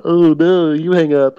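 sappy lovey-dovey phone voice; late night call with crush. Retold or imitated. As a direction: coo it like a lovesick teen. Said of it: "be in the corner on the phone".

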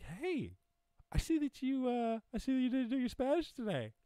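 very high, squeaky-pitched creature voice; nagging owl mascot. Acted. Owl popping up over missed practice, nagging falsetto. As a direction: pester in a falsetto cartoon voice.

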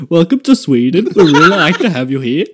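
loud, exaggerated sing-song Swedish accent; mock Swedish greeter. Guest mocking the fake Swedish accent, loud dopey lilt. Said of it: "sounds like this to speaking to".

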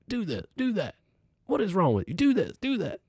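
put-on high, barking old-man orders; impatient old therapist. Old physical therapist barking orders at his patient. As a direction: rattle off commands like a cranky old coach. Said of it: "He starts yelling at me".

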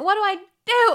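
high, loud wail; hammed-up desperate wail; desperate cry. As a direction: throw up your hands in despair. Man in tears.

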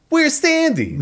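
high, confused greaser; old Danny Zuko. Acted. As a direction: call out lost and old. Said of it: "old Danny Zuko going".

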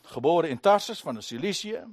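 hoog en luid verkondigd; hartstochtelijke toespraak. Acted. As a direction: verklaar het plechtig aan de menigte. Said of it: "Dan zegt hij weer". (Paulus verdedigt zich luid voor het volk)

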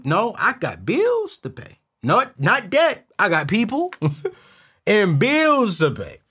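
loud, slow, high insistence; emphatic protest. Real, not acted. To the audience who think owners are rich, loud and slow.

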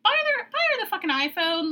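blurting out what he should have bought, exasperated; exasperated outburst. Real, not acted. Telling the boyfriend what he should have bought, exasperated outburst. Exasperated advice about the gift.

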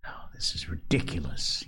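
soft, contemptuous hushed aside; scornful whisper. Acted. The Lord of the Flies mocking Simon's escape, scornful whisper. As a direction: dismiss him under your breath.

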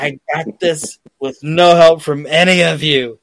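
hammed-up resentful shout; mock yell. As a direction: yell it at the whole table, half joking. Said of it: "he's just yelling at his entire family".

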